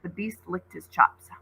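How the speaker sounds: plain reading voice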